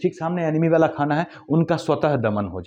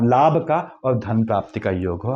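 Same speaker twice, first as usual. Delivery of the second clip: ordinary delivery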